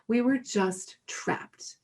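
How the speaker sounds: usual speaking voice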